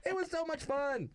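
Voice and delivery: high-pitched, gushing delight; gushing fond memory. Acted. Grown daughter remembering joke-writing with dad, high gushing delight. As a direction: gush it high like an excited kid.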